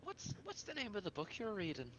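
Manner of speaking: high-pitched, would-be casual question; feigned casual. Acted. Talon fishing about Vin's book, feigned casual.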